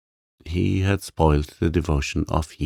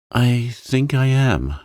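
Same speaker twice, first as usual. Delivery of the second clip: Sarah admits it, higher and louder, taking her time; woman admitting she is angry, said slow and louder; slow, raised, deliberate admission; 7 semitones higher, 6 dB louder than usual. A narrator's reading. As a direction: say it slowly and firmly.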